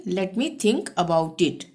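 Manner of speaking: each English word said very slowly and clearly; slow dictation. Shown as a demonstration. Teacher dictating the English sentence, very slow. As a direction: spell it out slowly for learners. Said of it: "अंग्रेजी में कैसे बोलेंगे?".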